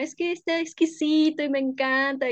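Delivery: lightly voiced food rapture; gushing over food. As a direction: rave to mom about how tasty it is. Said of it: "y siempre le digo a mi mamá".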